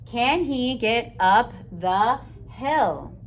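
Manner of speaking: drawn out, each word separate; slow reading. Shown as a demonstration. Teacher rereading the finished sentence slowly to the class. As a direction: read it back slowly to check. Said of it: "Slow down and take it slow".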